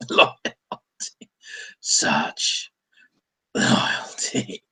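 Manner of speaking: a rasping breathy scoff at loyalty; mocking wolf voice. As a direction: sneer the word like a sly beast. Wolf voiced by game master.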